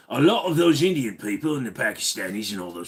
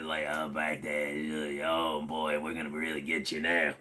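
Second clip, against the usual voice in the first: strained, failing voice; voice going bad; 10 semitones lower, 9 dB quieter than usual. Real, not acted. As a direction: let the voice crack and fade. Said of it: "my voice is trying to go bad".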